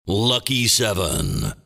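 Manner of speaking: ordinary delivery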